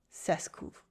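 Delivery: "Ça se couvre" flüsternd ohne Stimme; whisper. Shown as a demonstration. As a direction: hauch es nur. Whispered repeat of phrase.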